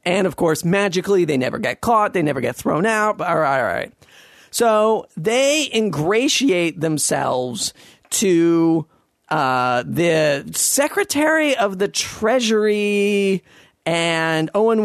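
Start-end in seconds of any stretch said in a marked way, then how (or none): none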